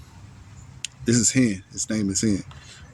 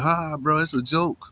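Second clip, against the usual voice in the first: high, airy brush-off voice; pitched-up scoffing imitation; mocking dismissive quote; 7 semitones higher, slower than usual. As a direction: mimic someone laughing it off. Mocking people who dismiss it.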